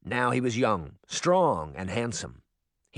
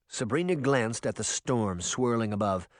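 usual speaking voice

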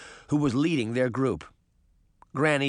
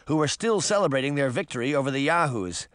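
plain reading voice

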